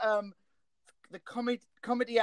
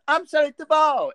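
Shelley Duvall line; 10 semitones higher, 8 dB louder than usual. Retold or imitated. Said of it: "I just come out with".

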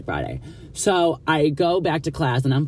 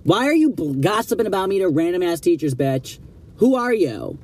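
angry rant; 6 semitones higher than usual. Retold or imitated. Student chews out the classmate who snitched, high angry rant. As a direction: relive the fury at the snitch, pitch flying up.

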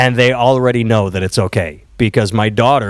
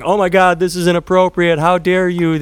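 higher, prissy mock outrage; mock outraged parent; 7 semitones higher than usual. Mocking parents who freak out, higher scandalized mock outrage. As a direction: ham up a scandalized pearl-clutching parent. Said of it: "I'm not freaking out and going".

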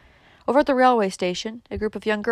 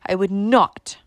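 louder, emphatic grand pronouncement; majestic; 7 dB louder than usual. A narrator's reading. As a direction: lay down the law with stately weight. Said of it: "said Missus Frederick majestically".

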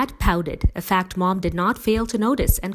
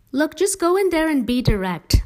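instructing; 11 semitones higher, 5 dB louder than usual. A narrator's reading. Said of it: "Mom instructed".

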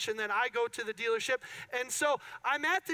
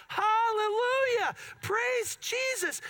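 much higher, louder, slow and drawn out; joyful praise; 10 semitones higher, 3 dB louder, slower than usual. Retold or imitated. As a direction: shout it like a jubilant amen. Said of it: "And I was so excited".

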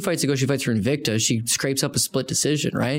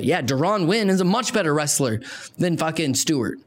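ordinary delivery

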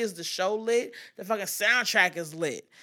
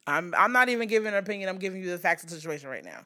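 ordinary talk

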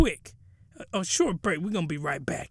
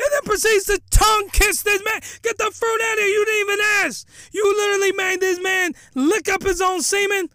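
loud, high outraged yelling; outraged shout; 10 semitones higher, 4 dB louder than usual. Real, not acted. Podcaster shouting at the anime scene in outrage. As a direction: blow up in disbelief at the screen.

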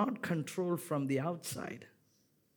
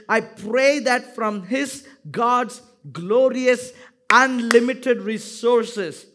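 slow, loud and high, every word proclaimed; booming scripture reading; 6 semitones higher, 10 dB louder, slower than usual. Shown as a demonstration. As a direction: read the verse out with full force for the church to follow. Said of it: "Come on, read it out".

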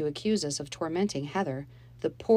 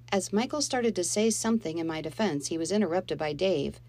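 ordinary delivery, nothing marked

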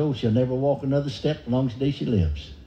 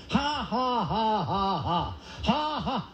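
forced fake laugh; 5 semitones higher, 4 dB quieter, much slower than usual. Shown as a demonstration. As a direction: put on a slow, evenly spaced fake laugh. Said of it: "putting it on".

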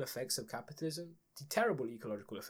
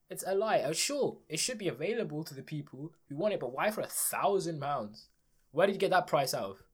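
ordinary talk, nothing marked

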